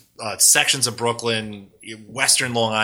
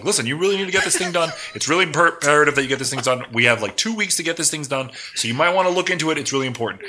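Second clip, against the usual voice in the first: fast, higher-pitched New York urgency; rapid-fire talking; 4 semitones higher, faster than usual. Retold or imitated. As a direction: rattle off instructions without leaving gaps. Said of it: "I'll speak so fucking fast".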